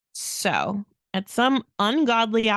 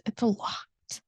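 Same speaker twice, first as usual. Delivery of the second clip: whispered repeat, softer; 5 dB quieter than usual. Real, not acted. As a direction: drop to a whisper.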